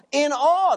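In all, with all pushed up high and loud; stress on all. Shown as a demonstration. Preacher to congregation, hammering the word all. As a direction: lean hard on the word all. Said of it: "Notice that word all".

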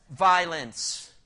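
Violence said loud and heavy, a falling punch; emphatic. Shown as a demonstration. Preacher answering his own question, Violence said loud and falling. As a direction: land Violence hard and loud. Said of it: "you tell me, what was it filled with?".

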